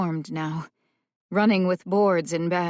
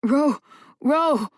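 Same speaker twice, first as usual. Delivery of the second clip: desperate broken call; 8 semitones higher, 6 dB louder than usual. A narrator's reading. Boy tries calling the victim's name.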